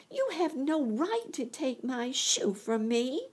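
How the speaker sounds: put-on high, indignant little girl; indignant Dorothy. A narrator's reading. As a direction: protest hotly. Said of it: "cried Dorothy".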